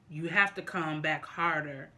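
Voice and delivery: ordinary talk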